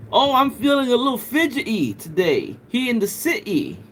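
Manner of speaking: "pretty" with the T dropped, posh British vowels; put-on British English accent. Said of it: "Am I doing it right?".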